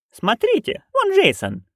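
high, excited calling out; happy exclaim. A narrator's reading. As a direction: point them out with glee. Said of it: "воскликнула счастливая нянюшка".

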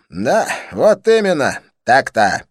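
loud, emphatic self-agreement; excited exclamation. A narrator's reading. Dean slapping his forehead, loudly agreeing with himself. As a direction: declare it slowly and very loudly.

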